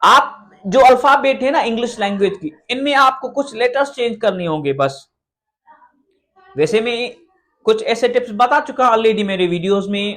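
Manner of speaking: ordinary talk, nothing marked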